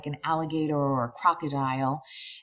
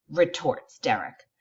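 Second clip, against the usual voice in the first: plain reading voice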